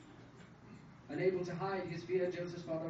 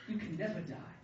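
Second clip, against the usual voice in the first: usual speaking voice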